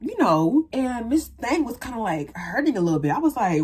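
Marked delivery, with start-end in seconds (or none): none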